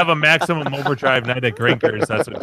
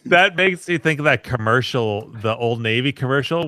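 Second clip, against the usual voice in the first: normal talking voice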